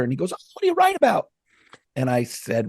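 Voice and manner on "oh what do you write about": much higher, bright eager question; retelling a fan's high, eager question; eager curious stranger. Retold or imitated. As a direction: play up the stranger's keen interest.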